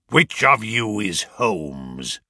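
slow, lightly gruff-voiced intruder; a hint of a menacing old man; menacing old visitor. A narrator's reading. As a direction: demand it slowly, sizing them up. Apparition demands who Holmes is.

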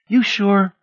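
high, coaxing tease; playful bedroom needling; teasing. A narrator's reading. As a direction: tease him with a lilting question. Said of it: "she asked teasingly".

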